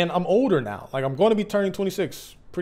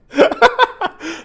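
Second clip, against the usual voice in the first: loud high laugh; 9 dB louder than usual. Real, not acted.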